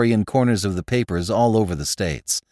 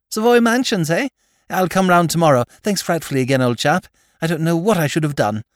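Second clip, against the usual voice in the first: much higher, bright, gushing toff voice; cheerful young lord; 8 semitones higher, 4 dB louder than usual. Acted. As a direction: gush your thanks like a giddy aristocrat. Lord Drever thanks Jimmy again.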